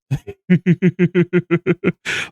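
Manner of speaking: short amused laugh. Real, not acted. Player laughs at the endless game choices, amused laugh.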